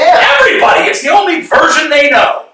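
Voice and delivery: high and loud, exasperated; loud, exasperated cry; exasperated shout. Acted. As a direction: shout him down. Exasperated reply in argument.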